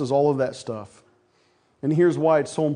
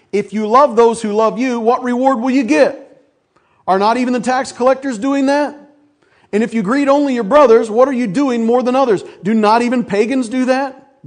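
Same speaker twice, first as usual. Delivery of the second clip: louder, higher preaching read; preaching scripture; 6 semitones higher, 6 dB louder than usual. Acted. Pastor reads Matthew to the church, loud and preaching. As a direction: proclaim each question to the congregation.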